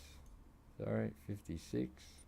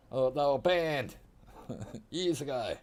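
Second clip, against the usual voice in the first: loud joking; 6 semitones higher, 5 dB louder than usual. Pretend. Loud slow joking reply.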